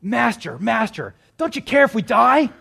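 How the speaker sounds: loud, high, frightened cry for help; shrill panic rising on each word; panicked cry. Acted. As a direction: call out to the master in terror of drowning. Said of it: "they cry out".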